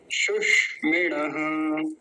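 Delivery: सुष्मिणः very slowly, half sibilant held; demonstrated सुष्मिणः. Shown as a demonstration. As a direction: draw out सुष्मिणः and sound the final visarga. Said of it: "ऐसा". (सुष्मिणः का धीमा शुद्ध उच्चारण)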